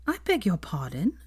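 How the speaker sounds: ordinary delivery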